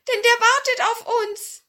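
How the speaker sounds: very high, loud dwarf voice; high-pitched, anxious little dwarf. Acted. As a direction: urge them on in a squeaky voice. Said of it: "sagte der".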